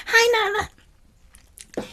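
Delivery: put-on "Hi, Nana" drawn out very high and slow, childlike; lightly imitating a toddler's happy hello; sweet little niece. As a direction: sing it out like a delighted small child greeting grandma. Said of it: "she goes".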